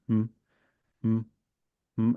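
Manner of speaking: brief closed-mouth hums, spaced apart; a little test hum, repeated; short hums. Shown as a demonstration. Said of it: "And so I'd go like this".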